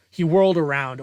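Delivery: plain reading voice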